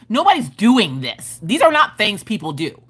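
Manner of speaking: ordinary talk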